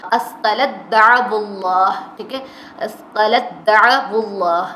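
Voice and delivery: اثقلت دعو اللہ slow and loud, the ت swallowed into a doubled د; ta merged into dal. Shown as a demonstration. Teacher showing students the merged اثقلت دعو اللہ. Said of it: "تا ساکن آگے دا کے اوپر شد ہوگی".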